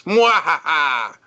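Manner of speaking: slow, shouted villain laugh; big hammy evil laugh; evil cackle. Retold or imitated. Mocking Honest Red as villain.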